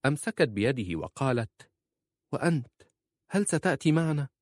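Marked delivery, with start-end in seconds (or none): 2.3-4.3 much higher, pleading woman's voice; high, worried question; anxious wife. A narrator's reading. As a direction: ask him anxiously, high-pitched.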